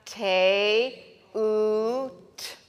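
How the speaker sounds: "te, oot" said as two slow separate syllables, te then oot; slow syllable demo. Shown as a demonstration. Teacher sounding out "te, oot" syllable by syllable for students. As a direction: sound out "te" and "oot" one by one for the class. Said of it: "So you're gonna say".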